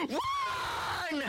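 very high, drawn-out scream to flee; alarmed yell. Acted. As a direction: shriek the warning in one long cry. Toy yells to flee monster.

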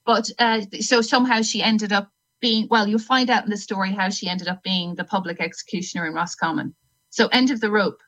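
ordinary delivery

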